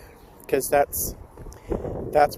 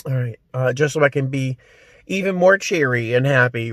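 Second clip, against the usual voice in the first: ordinary talk, nothing marked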